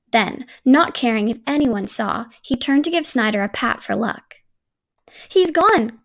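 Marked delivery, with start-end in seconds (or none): 5.3-6.0 squeal. A narrator's reading. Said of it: "Tuck squealed".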